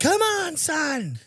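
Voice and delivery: high-pitched coach yelling encouragement; hype coach. Retold or imitated. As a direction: fire him up like his coach. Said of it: "you have to hear his coach like".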